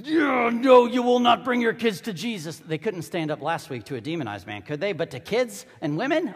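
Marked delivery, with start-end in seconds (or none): 0.0-2.6 loud, higher, mock-tough refusal; Secret Service blockers. Disciples block parents like Secret Service, loud and officious. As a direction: bar the way like overzealous bodyguards. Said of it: "they stand up like the Secret Service".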